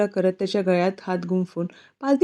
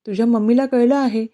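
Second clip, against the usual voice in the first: ordinary delivery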